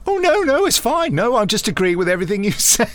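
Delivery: high, loud mock reassurance; a put-on high voice, breezily brushing it off; imitating David. Director imitates the actor reassuring him, high and loud. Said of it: "And he was, you know".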